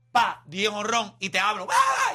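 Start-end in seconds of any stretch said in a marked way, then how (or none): 1.7-2.1 sudden high shout at the batter; mock yell. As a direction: yell it in his face, mock-angry. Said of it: "y te grito". (grito burlón y agudo)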